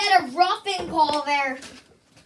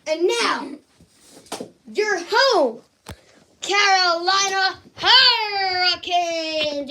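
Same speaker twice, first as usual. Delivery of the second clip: announcer intro; 3 semitones higher, much slower than usual. Pretend. Kid introducing the home team, stretched announcer call. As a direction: boom the home team name out slowly.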